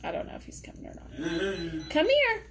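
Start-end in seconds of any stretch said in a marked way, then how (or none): 1.9-2.4 high, louder call to the dog. Real, not acted. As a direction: call him over from the other room.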